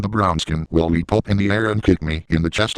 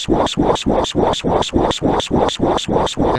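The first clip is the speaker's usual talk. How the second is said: breathy unvoiced swa swa squirts; squirting noise; slower than usual. Comic squirting noise in a crude story. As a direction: spit out voiceless squirt sounds.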